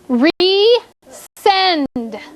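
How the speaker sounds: RESEND split into RE, pause, SEND; syllable by syllable. Shown as a demonstration. Teacher giving the class the next word, syllable by syllable. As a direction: say RESEND slowly with a clear gap after RE. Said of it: "figure out how many syllables there are".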